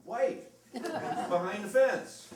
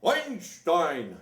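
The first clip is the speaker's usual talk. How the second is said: put-on loud German-accented call across the plaza; booming call; 3 semitones higher, 8 dB louder than usual. Hotel man Hans calling across the plaza, booming. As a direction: boom the name out like a big hotel man. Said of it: "And I hear this booming voice".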